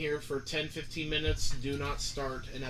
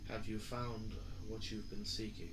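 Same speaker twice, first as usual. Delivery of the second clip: disguised deep voice; 4 semitones lower, 10 dB quieter than usual. Acted. GM voicing deep entity.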